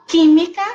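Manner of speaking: química called out high and loud, stressing the first syllable "quí"; teacher calling out an example word; emphasized example word. Shown as a demonstration. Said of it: "la antepenúltima es la tónica".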